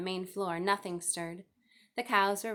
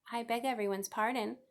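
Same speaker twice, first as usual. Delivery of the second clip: ordinary delivery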